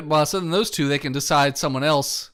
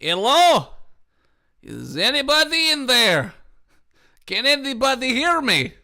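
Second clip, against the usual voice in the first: calling out; 6 semitones higher than usual. Acted. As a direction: call into the dark room. Calling out in empty classroom.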